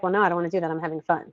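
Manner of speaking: a grumbling little one who won't budge; a hint of a sulky child; mock whiny kid. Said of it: "they're like".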